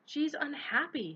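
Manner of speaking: fed-up burst; exasperated. A narrator's reading. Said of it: "said Hermione exasperatedly".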